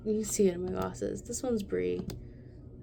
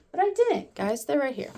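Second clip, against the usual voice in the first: relieved; 5 semitones higher than usual. Real, not acted. Relieved pencils found.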